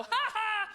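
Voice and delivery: high, empty fake giggle; mock baby laugh. Comic answers French speaker like a baby, high fake laugh. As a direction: laugh high as if understanding nothing. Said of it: "and I just go".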